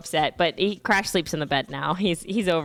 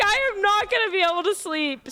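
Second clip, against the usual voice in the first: mock despair; 11 semitones higher than usual. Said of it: "I'm like".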